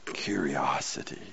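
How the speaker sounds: a hushed whisper of the one word. Shown as a demonstration. Preacher to the church, whispering the word. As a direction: breathe it out without voice.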